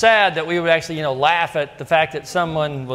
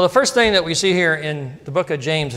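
ordinary talk

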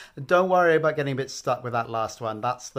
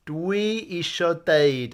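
"Dwi isio deud" said slowly and clearly; teacher's slow clear model; slow model phrase; 3 semitones higher, slower than usual. Shown as a demonstration. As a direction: lay out each word for learners to copy. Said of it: "I want to say".

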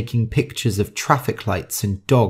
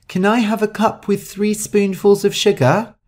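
much higher, small and sweet request; high-pitched voice; 9 semitones higher than usual. A narrator's reading. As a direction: ask like a little girl wanting sugar. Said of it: "in her high-pitched voice".

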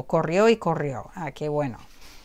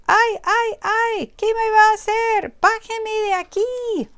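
high, loud panicked chick; high frightened bird voice; frightened cry; 14 semitones higher, 4 dB louder than usual. Acted. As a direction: plead to be let down. Bird lifted into the air.

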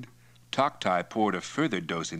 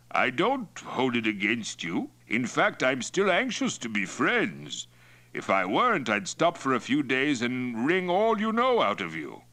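higher, swaggering chief's voice; Mongol chief Toktai, affably menacing; 6 semitones higher than usual. Acted. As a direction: threaten him in a friendly tone.

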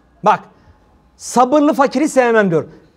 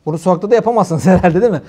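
ordinary talk, nothing marked

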